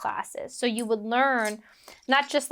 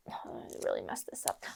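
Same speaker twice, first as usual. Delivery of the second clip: very soft whispered aside; whispered self-reproach; 16 dB quieter, faster than usual. Real, not acted. Storyteller botching the quote, whispered aside. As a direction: whisper it to yourself, dropping out of character. Muttered aside about own mistake.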